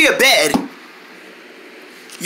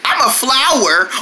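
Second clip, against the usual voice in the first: ordinary talk, nothing marked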